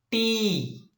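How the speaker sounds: "T" drawn out high and firmly; emphasised first syllable. Shown as a demonstration. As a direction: give "T" full stress, high and held.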